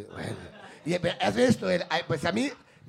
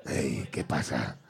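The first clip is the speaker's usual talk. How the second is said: very low and slow laughing greeting; Jovic's put-on voice; 5 dB quieter, much slower than usual. Jovic greeting in disguise, very low and slow. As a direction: drawl it way down in a fake voice. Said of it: "cambia la voz".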